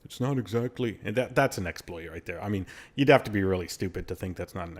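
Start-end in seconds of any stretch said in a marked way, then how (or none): 0.0-1.0 imitating players making lame excuses; lightly put-on voice of someone rationalizing; mock justifying. Exploiters justifying it as part of the game, mock defensive. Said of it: "they'll justify and rationalize it".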